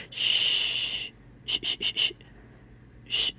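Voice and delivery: unvoiced shh, very soft; soft shushing sounds; whispered shushing. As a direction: hush someone gently. Spirit's image of hushing.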